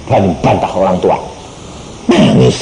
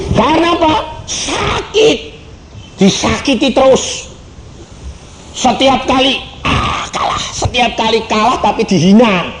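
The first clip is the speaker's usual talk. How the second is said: much higher pained cry; anguished exclaim; 13 semitones higher than usual. Acted. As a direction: voice the hurt, pitched high. Recalling pain, high exclaim. (seruan sakit hati bernada tinggi)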